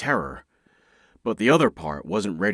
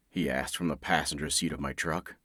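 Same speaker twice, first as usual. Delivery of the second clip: ordinary delivery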